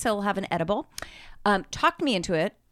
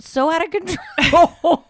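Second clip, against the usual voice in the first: slow, loud, high confession through laughter; real laughing speech; 7 semitones higher, 5 dB louder, much slower than usual. Real, not acted. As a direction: drag it out while cracking up. Recalls giggles, laughing.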